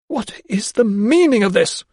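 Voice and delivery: high, loud barked question; barked demand. Acted. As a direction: demand an answer at once. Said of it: "she barked".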